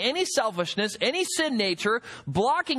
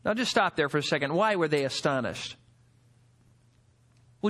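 usual speaking voice

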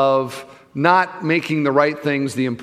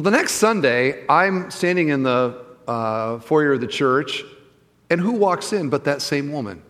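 usual speaking voice

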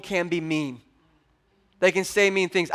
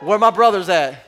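booming call to the men; loud call-out; 7 dB louder than usual. Real, not acted. Pastor shouting out to the men in the room. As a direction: bellow it out to the brothers. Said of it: "It's just bark".

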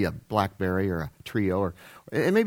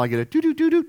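phone chime imitation; faster than usual.

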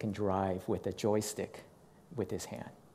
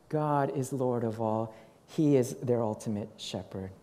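normal talking voice

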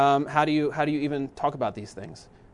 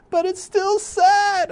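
very high, drawn-out mock wail; loud, squeaky pretend bawling; imitated crying child; 7 dB louder, much slower than usual. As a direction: wail it like a weeping little boy. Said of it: "he's just crying and weeping".

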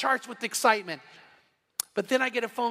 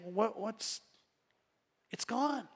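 hushed lament; 9 dB quieter, slower than usual. Retold or imitated. Lying in bed, lamenting lost intimacy.

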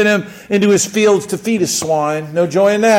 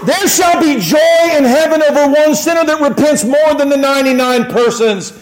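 high, loud scripture proclamation; full-force preaching; 10 semitones higher, 6 dB louder than usual. Acted. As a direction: declare the verse triumphantly.

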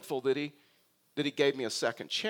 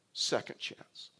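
breathy whisper of the refrain; 5 dB quieter, slower than usual. Acted. Preacher to the congregation on a second chance, whispered. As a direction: drop to a whisper on it. Hushed repeat of sermon refrain.

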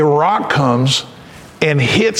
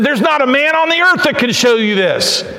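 higher, pleading voice of the frightened wise men; desperate Chaldean advisors; 7 semitones higher than usual. Acted. Advisors pleading with the king, in a high desperate voice. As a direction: beg the king that nobody can do this. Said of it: "and they said".

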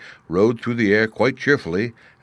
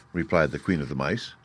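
plain reading voice

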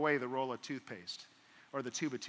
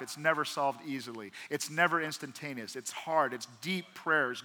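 ordinary talk, nothing marked

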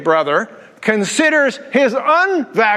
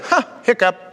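shrugging God. Pretend. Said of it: "He's looking down and going".

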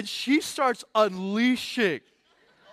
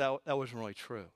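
softer, lower, gentle correction; gentle Holy Spirit; 7 semitones lower, 11 dB quieter, faster than usual. Retold or imitated. Holy Spirit gently urging believers to apologize, soft low voice. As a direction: speak to us gently, like a mentor. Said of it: "and say".

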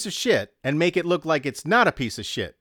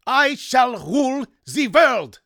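high, loud, slow German tyrant; William Alexander, German accent; 13 semitones higher, 5 dB louder, slower than usual. A narrator's reading. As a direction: proclaim it slowly in a hammed-up German accent. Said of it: "did his William Alexander impersonation".

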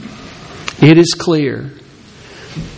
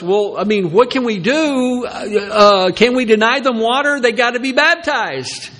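hesitant Peter; 7 semitones higher than usual. Retold or imitated. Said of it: "He goes".

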